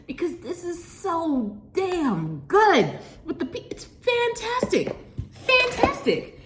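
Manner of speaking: very high, thrilled fantastic, slowing on the last one; gushing delight. Real, not acted. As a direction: squeal with pleasure over the food. Raves about the sauce.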